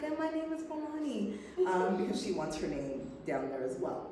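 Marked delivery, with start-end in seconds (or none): 0.0-1.4 put-on high, proud little girl introducing herself. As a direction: voice a tiny child announcing her name. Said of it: "her little self should be like".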